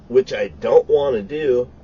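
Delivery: ordinary talk, nothing marked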